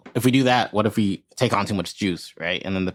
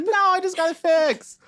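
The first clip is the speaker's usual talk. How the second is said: much higher squeaky panicked voice; high panicked goblin voice. Retold or imitated. Player voices own panicked thought.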